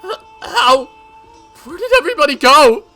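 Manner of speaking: high, loud, fast stammering cry; panicked. Acted. Mion finding everybody gone, panicking. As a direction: cry out in bewildered terror. Mion finds nobody comes.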